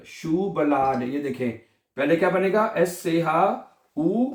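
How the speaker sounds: ordinary talk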